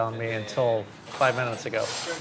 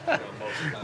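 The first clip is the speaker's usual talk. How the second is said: laughing; 4 semitones higher, 5 dB quieter than usual. Real, not acted. Laughs at his own joke.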